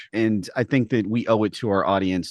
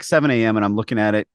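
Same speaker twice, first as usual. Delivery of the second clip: ordinary delivery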